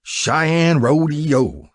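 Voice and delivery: "Cheyenne Rodeo" very slow, each syllable apart, high and loud; syllables parsed out. Acted. Jack mocks Buck's rodeo buckle, "Cheyenne Rodeo" spelled out slowly. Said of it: "parsing out the syllables".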